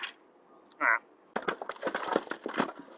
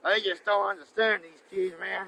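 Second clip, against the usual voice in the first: normal talking voice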